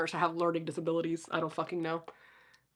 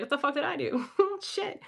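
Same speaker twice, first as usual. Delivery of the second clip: laughing; 6 semitones higher, 5 dB quieter than usual. Retold or imitated. Laughs at her past self.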